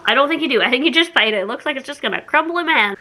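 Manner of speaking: ordinary talk, nothing marked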